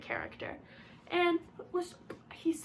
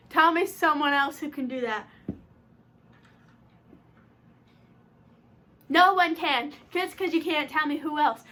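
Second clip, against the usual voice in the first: loud, raised-pitch fan rant; passionate shouting; 4 semitones higher, 4 dB louder than usual. Real, not acted. Fan defending Wanda's powers to viewers, shouting. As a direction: argue it forcefully, daring anyone to disagree. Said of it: "I'm sorry that I'm shouting".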